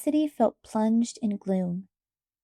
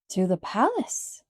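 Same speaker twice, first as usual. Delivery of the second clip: exclaiming; slower than usual. A narrator's reading. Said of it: "Mister Merriman exclaimed".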